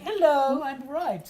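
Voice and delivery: high, loud hello easing off; energetic entrance. Retold or imitated. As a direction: burst in announcing yourself. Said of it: "where did this ball of energy come".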